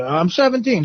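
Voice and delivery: high put-on teenage girl voice; seventeen-year-old girl. Girl telling the man her age, in a high put-on voice. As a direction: pitch it up as the young caller. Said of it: "How old are you?".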